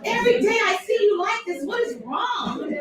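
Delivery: high, loud exasperated scolding with a laugh in it; hammed-up nagging at a grumpy friend; mock exasperation. Imitating someone fed up with an always-mad person, mock exasperation.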